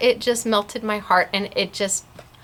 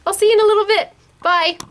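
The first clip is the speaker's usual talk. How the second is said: high, bright sign-off; cheery goodbye; 9 semitones higher, 4 dB louder, much faster than usual. Real, not acted. Host signing off to viewers, high and cheery. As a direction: sing out a quick farewell.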